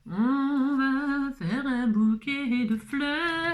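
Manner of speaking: drawn out. Pretend. Crafter stretching out the line playfully while working. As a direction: draw out each word slowly and sing-song.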